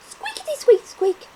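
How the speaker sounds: high, loud squeaky scamper; squeaky scurry. Acted. Mouse scurries into the forest, squeaking. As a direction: squeak it out as he runs.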